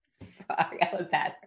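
soft, voiceless sheepish apology; sheepish whisper. Real, not acted. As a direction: whisper the sorry, embarrassed.

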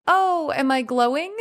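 lightly playful, teasing lilt. Shown as a demonstration. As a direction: tease it, coy and light. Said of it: "Said in the right tone of voice".

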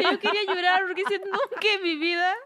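high, loud, laughing to the point of tears; tearful laughing. Real, not acted. Retelling how she nearly cried after falling, tearfully laughing. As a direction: push the words out through laughter.

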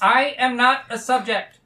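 firm, forceful objection; defiant protest. Acted. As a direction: stand your ground loudly. Defiant objection to being studied.

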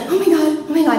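high, loud panicked exclamation; freaking out. Retold or imitated. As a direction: cry out as fear takes over. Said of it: "I'm freaking out".

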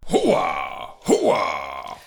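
low booming Hooah shout; loud gung-ho soldier. Retold or imitated. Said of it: "Scent of a Woman".

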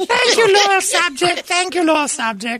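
pompous royal. Pretend. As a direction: play a pompous monarch. Pretends to be a knighted subject.

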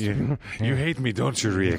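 lightly voiced whiny Peter Lorre; a hint of Peter Lorre pleading. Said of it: "you're sneaking Peter Lorre in there".

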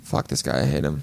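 low, quiet mock-bitter aside; mock jealousy. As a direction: grumble it softly, pretending to hate him. Mock jealousy of praised cousin.

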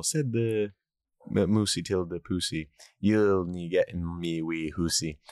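slow Scottish recitation with long oo vowels; Scottish dialect. Retold or imitated. Host reciting a poem to co-host, Scottish accent. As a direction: recite a rhyme in put-on Scots.

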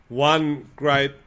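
normal talking voice

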